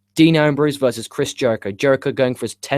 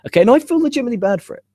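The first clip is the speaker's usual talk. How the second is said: excited; 11 semitones higher, 4 dB louder, much faster than usual. Real, not acted. Guilty outburst, fast and high.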